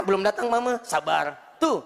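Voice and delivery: normal talking voice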